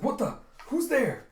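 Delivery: loud, high-pitched alarm; startled shout. Retold or imitated. Guard startled by an arrow flying by, alarmed shout. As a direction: jump in pitch and demand an answer.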